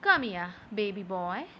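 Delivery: normal talking voice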